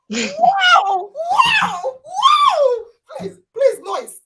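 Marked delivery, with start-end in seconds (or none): 0.1-2.8 high, loud, drawn-out cries of wonder; amazed wows. Real, not acted. Host blown away by a child's definition, loud amazement. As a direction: whoop in real astonishment.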